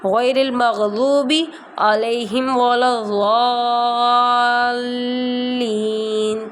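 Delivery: slow, drawn-out tajweed chant; slow Quran recitation. Shown as a demonstration. Teacher reciting slowly for students to follow, chanted. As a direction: recite it slowly for the class to repeat. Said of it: "ولا الضالین بھی آپ اس کو".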